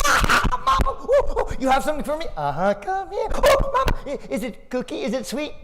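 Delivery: put-on very high, laughing child voice; excited little boy. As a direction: squeal like a kid expecting a present. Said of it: "he went".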